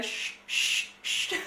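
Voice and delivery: hissed whisper shushing her; whispered chut. Retold or imitated. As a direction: hush her urgently.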